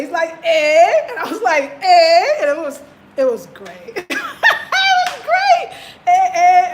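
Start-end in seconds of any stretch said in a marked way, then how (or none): 0.4-2.4 loud, laughing "Eh?"; loud amused surprise; surprised "Eh?". Retold or imitated. Mutual surprised exclamation. 4.1-5.8 high, slow words squeezed out through laughter; can barely speak for laughing; high-pitched laughing delight; laughing hard. Real, not acted. Laughing recounting. 6.1-6.7 loud, playful "eh, eh"; loud playful surprise; surprised "eh, eh". Retold or imitated. Surprised exclamation.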